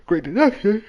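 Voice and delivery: words stretched by a real yawn. Real, not acted. Streamer reading the detective's boast, yawning. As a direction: yawn right through the words. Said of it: "sorry, I'm yawning too much".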